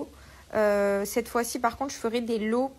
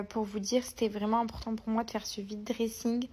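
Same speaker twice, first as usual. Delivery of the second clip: ordinary delivery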